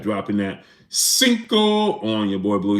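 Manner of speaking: slow, drawn-out hype call; shoutout catchphrase. Acted. Streamer thanking a donor, slow announcer drawl. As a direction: stretch it out like an announcer.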